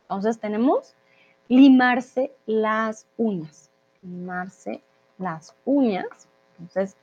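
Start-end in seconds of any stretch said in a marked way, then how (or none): 1.5-3.7 half-speed "limarse las uñas", falling at the end; firm, slow teaching take ending downward; slow model phrase. Shown as a demonstration. Teacher models new phrase slowly. 4.0-6.3 softer half-speed "Limarse las uñas", rising at the end; quiet, slow echo with a lifted ending; slow repetition. Shown as a demonstration. Teacher repeats new phrase for learners.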